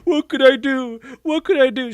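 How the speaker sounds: high whine breaking into a laugh; laughing mock whine. Streamer laughs while mocking the weak hero's whine. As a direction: laugh through the whiny impression.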